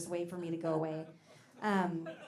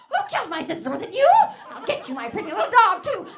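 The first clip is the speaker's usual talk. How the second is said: very high, much louder witch screech; Wicked Witch; 12 semitones higher, 14 dB louder than usual. Pretend. Comedian doing the Wicked Witch threatening Dorothy. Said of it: "Wizard of Oz".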